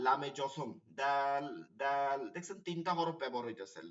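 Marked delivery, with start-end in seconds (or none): 1.0-2.2 slow "দাল" with a long held aa; stretched vowel. Shown as a demonstration. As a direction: draw out the vowel in each "দাল". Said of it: "এক আলিফ করে টেনে টেনে". (ধীরে এক আলিফ টেনে "দাল")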